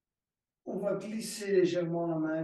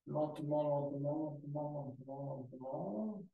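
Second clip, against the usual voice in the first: lentement repeated, sinking from soft to a near-silent hush; 8 dB quieter than usual. Shown as a demonstration. Yoga teacher easing students' arms down, fading to a hush. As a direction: let each lentement fade away as the arms come down. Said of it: "coach slowing the arms' descent".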